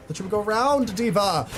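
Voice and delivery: exasperated yell. Real, not acted. Gamer yelling at teammate who didn't go around, exasperated. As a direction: yell it again, fed up.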